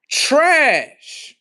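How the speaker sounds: a loud, scornful one-word verdict. Real, not acted. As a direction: spit out the word with contempt. Ranting at poor viewers.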